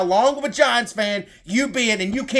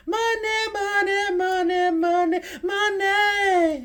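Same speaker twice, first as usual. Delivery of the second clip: sing-song money chant, much higher, drawn out with a laugh; mock the million dollar man's money chant; Ted DiBiase; 9 semitones higher, slower than usual. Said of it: "like he's Ted DiBiase or something".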